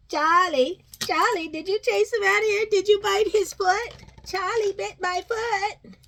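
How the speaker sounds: pretend-stern talk to dog; mock scolding pet. Real, not acted. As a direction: tease the dog for biting.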